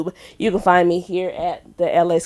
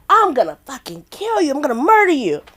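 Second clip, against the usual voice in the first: higher-pitched hammed-up threat; mock murderous threat; 7 semitones higher than usual. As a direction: play-act a worked-up threat. Re-enacts threat with knives.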